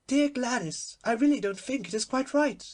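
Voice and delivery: plain reading voice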